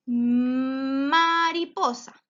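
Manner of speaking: slow, drawn-out reading of the word; slow syllable by syllable. Shown as a demonstration. Teacher sounding out a word for a child, slowly. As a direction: stretch each syllable so the child hears it. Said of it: "¿Con cuál empezaba mariposa?".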